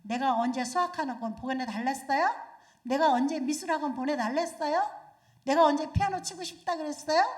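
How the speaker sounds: put-on child talking back to the parent, loud; defiant child. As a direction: throw the complaints back at the parent. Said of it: "어떤 애들은 이렇게 말해요".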